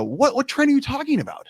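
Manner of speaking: high, baffled question; confused reply. Retold or imitated. Young him answering a visitor, high and baffled. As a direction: pitch up in disbelief.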